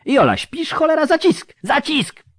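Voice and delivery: high, loud, exasperated yell; urgent shout. Acted. Surgeon yelling at Jola for a clamp, urgent shout. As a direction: shout the order at a dozing assistant.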